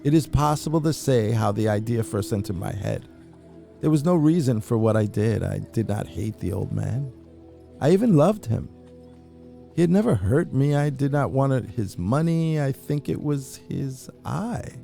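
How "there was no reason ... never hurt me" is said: ordinary delivery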